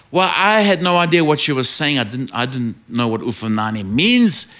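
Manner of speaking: usual speaking voice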